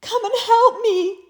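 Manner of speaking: a stuck bunny wailing for mommy; crying out for mommy. Acted. Rabbit stuck in prickles, crying high for mommy. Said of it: "he cried".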